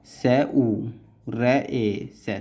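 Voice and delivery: slow, spaced dictation of each stroke; syllable by syllable. Shown as a demonstration. Teacher to student, spelling each stroke slowly. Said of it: "teacher spelling out shorthand strokes".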